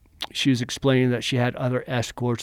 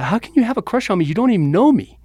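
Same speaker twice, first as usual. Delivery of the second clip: higher, louder disbelieving question; retold disbelief; 9 semitones higher, 6 dB louder, much faster than usual. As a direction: fire back fast and high in astonishment. Shocked reply to crush confession.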